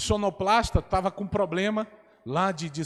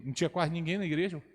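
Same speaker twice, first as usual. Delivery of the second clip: usual speaking voice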